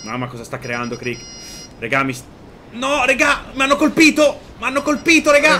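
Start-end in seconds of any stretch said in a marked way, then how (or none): 2.7-5.6 high, urgent panicked call; alarmed cry. Real, not acted. Pilot tells team he is hit, panicking. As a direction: cry out for help, voice pitched way up.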